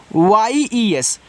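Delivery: slow, careful spelling of पी एल ई ए एस ई; letters spelled out one by one, slowly. Shown as a demonstration. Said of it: "spelling letters".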